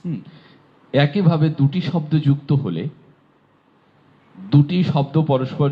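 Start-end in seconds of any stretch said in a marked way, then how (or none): none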